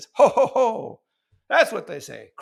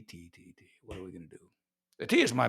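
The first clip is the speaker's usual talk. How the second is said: usual speaking voice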